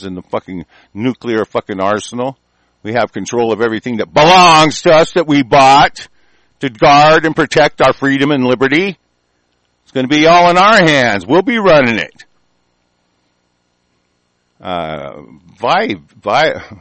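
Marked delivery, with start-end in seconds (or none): 4.1-12.2 loud, higher-pitched defiant proclamation; rallying shout. Real, not acted. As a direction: build into a booming rallying cry. Rallying rant about taking control.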